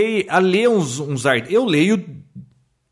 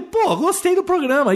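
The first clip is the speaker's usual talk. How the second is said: much higher-pitched retelling of pleasant surprise; surpresa retold; 13 semitones higher than usual. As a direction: recount being won over, voice jumping up high. Retells his surprised first reaction. (surpresa agradável relembrada, voz bem mais aguda)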